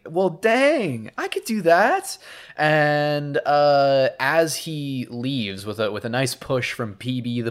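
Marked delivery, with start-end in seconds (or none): 0.1-2.2 mock eager fox. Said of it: "And he's like".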